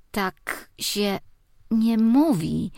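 put-on slow, high kid's reproach; a small boy scolding his aunt; child voice. Nephew scolding auntie for swearing, high slow kid voice.

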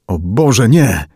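ordinary delivery

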